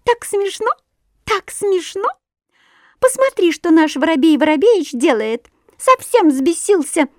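very high, giggly bird chatter; chirping titmouse. A narrator's reading. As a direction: twitter it out like a lively little bird. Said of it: "чиликнула бойкая синичка".

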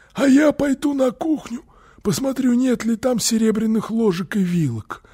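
very high, strained hoarse crook; Phille the burglar. A narrator's reading. Burglar goes off to check the kitchen silver, strained and high. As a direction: squeeze the voice up high and raspy. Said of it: "сказал Филли".

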